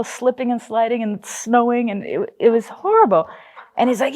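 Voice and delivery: ordinary talk, nothing marked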